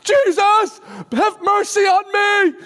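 loud, high-pitched desperate plea; shouting. Retold or imitated. Blind beggar begging Jesus for mercy, shouting. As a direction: cry out to be heard over the crowd. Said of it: "So he starts shouting".

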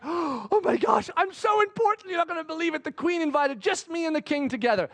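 high, giddy self-important gush; gushing excitement. Retold or imitated. Haman boasting to his wife, giddy and high. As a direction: gush like a puffed-up courtier.

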